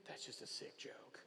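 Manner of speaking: hushed unvoiced scoff; a mocking whisper to the other disciples. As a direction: whisper it like a disgusted aside. Thomas scoffs in retold scene.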